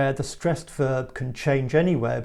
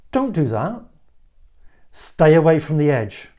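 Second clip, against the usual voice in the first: warning command; 3 semitones higher, 9 dB louder than usual. Shown as a demonstration. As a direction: command them with authority, building louder. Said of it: "a warning, a command made with authority".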